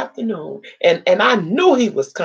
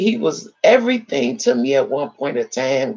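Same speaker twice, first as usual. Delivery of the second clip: normal talking voice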